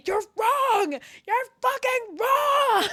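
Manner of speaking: high, drawn-out mock scream; hammed-up accusing shriek; gloating shout. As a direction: yell it triumphantly, stretching the last word. Retold gloating during a debate.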